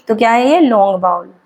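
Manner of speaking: normal talking voice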